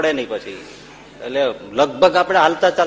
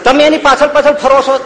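indignant rebuke; 13 semitones higher, 10 dB louder than usual. Retold or imitated. Swami rebukes the father.

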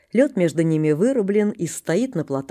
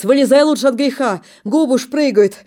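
urging loudly; 5 semitones higher, 7 dB louder than usual. Acted. Father urging out of water.

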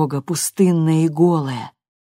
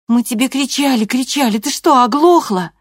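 put-on exasperated girl; 7 semitones higher, 4 dB louder than usual. A narrator's reading. Loredo scolding her mother.

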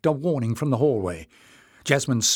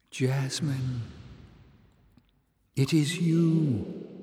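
put-on sweet young voice; 3 semitones higher, much slower than usual. Mysterious voice greeting Jasmine, sweet and warm. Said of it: "young, sweet and warm".

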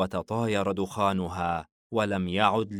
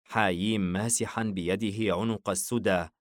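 usual speaking voice

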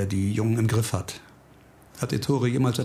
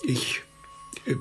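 whisper; 4 semitones lower, 5 dB quieter than usual. Acted. Unvoiced far whisper, shy farewell.

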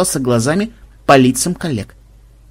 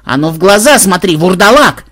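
loud, high accusing demand; angry challenge; 6 semitones higher, 7 dB louder than usual. A narrator's reading. Мелисса confronts the vampire about last month, loud and angry. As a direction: confront him and demand the truth.

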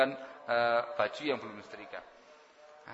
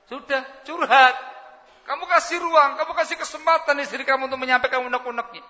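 much higher and louder, pressing the point; emphatic urging; 12 dB louder than usual. Real, not acted. Preacher urging husbands to let wives vent, loud and high. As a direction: drive it home to the congregation.

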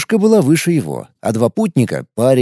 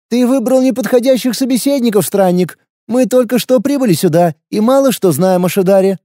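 put-on higher, tough young woman's voice; wary fighter, blunt and guarded; young warrior woman; 8 semitones higher than usual. A narrator's reading. Lina explains.

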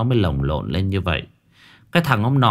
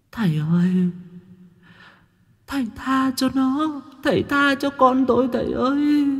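much higher pleading female ghost voice; wailing ghost mother. A narrator's reading. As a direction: beg the master for your son, wailing. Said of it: "tiếng khóc lóc nỉ non". (giọng đàn bà ma khóc lóc van xin)

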